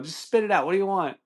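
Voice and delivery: high, snappy impatient demand; impatient coworker. Retold or imitated. Coworker to stuttering customer at the counter, impatient. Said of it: "the impatient lady".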